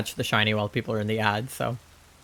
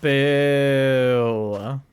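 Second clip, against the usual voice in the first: long drawn-out no and boo; playful booing; 3 dB louder than usual. Real, not acted. Streamer booing an egg that is not shiny, drawn-out disappointment. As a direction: stretch each word out in disappointment.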